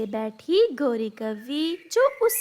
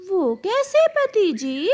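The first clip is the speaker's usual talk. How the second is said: eager; 6 semitones higher, 5 dB louder than usual. Acted. Bride eager about bigger house.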